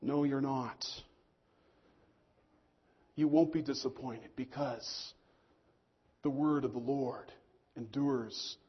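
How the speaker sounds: reassuring prophet. Retold or imitated. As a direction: settle their fears gently. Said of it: "And Isaiah says".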